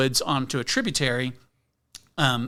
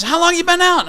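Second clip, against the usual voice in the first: high, loud yell across a river; ranger yelling; 10 dB louder than usual. Retold or imitated. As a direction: shout it across the water at a stranger. Ranger questioning.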